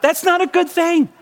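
high, slow, uneasy inner voice; husband's mock dread at the tray. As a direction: let the dread creep into it.

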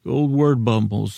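ordinary talk

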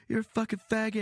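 high, loud jeering yell; drunk heckler shouting. Retold or imitated. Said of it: "an impression of a heckler".

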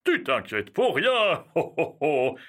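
jolly ho ho ho laughing off her fear; Santa laugh. Acted. Santa laughs off his worried elf with a jolly ho ho ho. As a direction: chuckle warmly through the reassurance.

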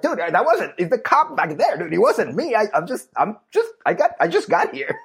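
high, panicked innocent protest; high-pitched mock defense of the camera guy; pleading moto cameraman. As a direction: squeak out your innocence. Camera guy defending himself, voiced.

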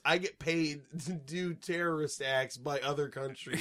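low, quiet deadpan spy; flat villain quote. Retold or imitated. Mocking the spy's voiceover, low and deadpan. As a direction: say it low and dry.

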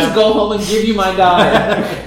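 high, loud, laughing disbelief at the mother; loud laughing outrage at a foolish parent; incredulous laughing rant. Retold or imitated. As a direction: scold the mother through laughter. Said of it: "And you're just like".

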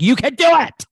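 cheering. Retold or imitated. Cheering on a student.

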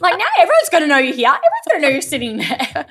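high, loud mock outrage; appalled scolding. Telling friend off for catching the ball, appalled.

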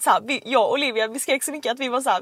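ordinary talk

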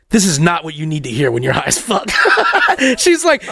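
ordinary talk

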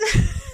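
a high burst of laughter. Real, not acted. Laughing at the end of her Highlander review.

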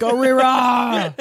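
high slow mock shout; alarmed shout. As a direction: yell it like a warning.

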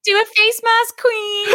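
high, camp sassy voice; mock fabulous coach voice; sassy spirit guide. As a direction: play a sassy drag-queen guide.

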